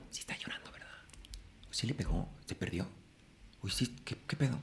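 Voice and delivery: hushed audience whisper; whispering to a friend during the show. Retold or imitated. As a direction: whisper like someone in a dark theatre.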